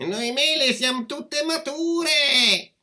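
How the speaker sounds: very high, slow plaintive call; crying-out apple tree. A narrator's reading. Apple tree begging the girl to shake it, crying out high. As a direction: stretch the cries out high as a talking tree. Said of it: "che gridò".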